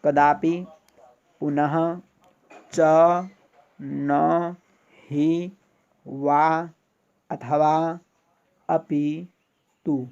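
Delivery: each word separate and drawn out, loud, lifting at the end; pronunciation demonstration. Shown as a demonstration. Teacher modelling Sanskrit indeclinables for students to hear, careful pronunciation demonstration. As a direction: model each Sanskrit word, from कदापि to तु, loudly and distinctly with pauses between. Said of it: "ध्यान से सुनिए".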